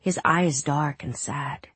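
usual speaking voice

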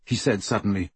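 usual speaking voice

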